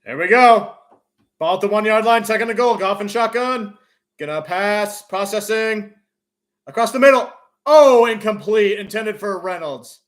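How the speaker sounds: tense goal-line call, bursting loud on the miss; live play-by-play. Real, not acted. Announcer follows a pass at the goal line, urgent play-by-play.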